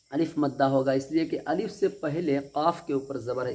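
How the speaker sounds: ordinary talk, nothing marked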